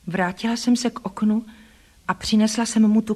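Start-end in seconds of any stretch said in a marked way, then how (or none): none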